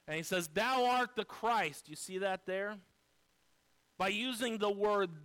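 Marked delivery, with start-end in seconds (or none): 0.5-1.9 slow, weighty proclamation; emphatic declaration. Retold or imitated. Peter speaking up first to Jesus, emphatic declaration. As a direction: declare it firmly, drawing out each word. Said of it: "Peter emphatically speaks up first".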